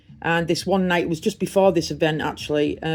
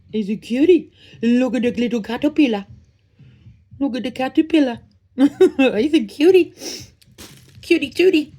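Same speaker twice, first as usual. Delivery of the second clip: cutesy cooing; 6 semitones higher, 4 dB louder than usual. Real, not acted. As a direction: coo over it like it's a tiny pet. Cooing over a cute caterpillar.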